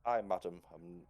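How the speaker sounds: put-on a proud old man insisting he is fine; stiff old gentleman. Gentleman Hubert answering Louise, stiff and proper.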